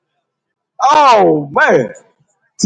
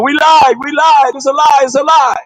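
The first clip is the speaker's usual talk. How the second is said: mock confession shout; 7 semitones higher than usual. Street preacher mocks liars shouting a confession. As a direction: yell it out like a caught liar confessing. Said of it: "Y'all should be on the internet saying".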